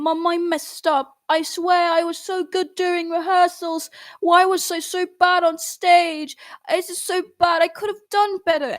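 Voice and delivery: high, pleading mock sob to mom; retold crying to mom. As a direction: replay the teary plea, pitched high. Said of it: "crying to my mom".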